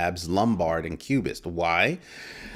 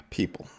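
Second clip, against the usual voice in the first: exasperated sigh; 7 dB quieter than usual. Retold or imitated. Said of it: "And I'm just like".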